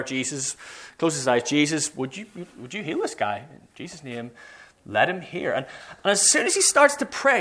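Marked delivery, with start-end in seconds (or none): 1.4-5.5 soft, low retold prayer; quiet acted-out petition; simple prayer. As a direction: pray it gently, voice dropping away. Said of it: "Very simple prayer".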